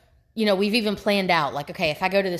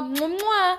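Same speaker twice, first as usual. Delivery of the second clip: high, playful kiss sounds; blown kisses; 11 semitones higher than usual. Real, not acted. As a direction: blow kisses to the audience. Signing off blowing kisses.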